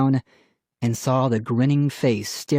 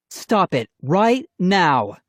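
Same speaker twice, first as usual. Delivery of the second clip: higher, slow firm order; stern command; 6 semitones higher, 3 dB louder, slower than usual. A narrator's reading. Mom stepping between quarrelling children, stern. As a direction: order it word by word.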